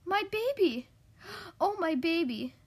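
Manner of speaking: high, loud motherly cry; distraught exclamation. A narrator's reading. Mother rushes to her rescued baby, emotional exclamation. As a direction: exclaim it high and breathlessly, storybook drama. Mother after baby's near accident.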